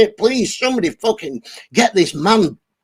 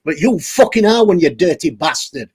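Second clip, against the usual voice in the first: ordinary delivery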